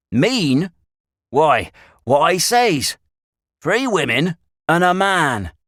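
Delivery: loud, high, drawn-out rustic retort; insistent country shepherd. A narrator's reading. Shepherd standing by his words to Stanley, insistent. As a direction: repeat it emphatically in country speech.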